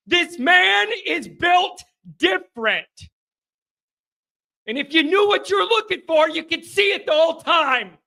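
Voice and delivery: fired-up host hailing the coach; hyped shouting. Real, not acted. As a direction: preach it like the team just won it all. Hyping the coach after a win.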